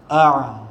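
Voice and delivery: "a" from the throat with the nose pinched; nose-pinched test. Shown as a demonstration. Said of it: "Kalau kita picit hidung ni".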